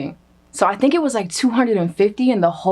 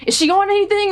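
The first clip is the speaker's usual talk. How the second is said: imitating suspicious guard; 12 semitones higher, faster than usual. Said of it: "And he was like".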